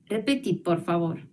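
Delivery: usual speaking voice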